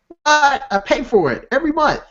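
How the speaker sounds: high-pitched incredulous outburst, retold; shocked retort. Patient to dentist's receptionist about lapsed insurance, shocked retort.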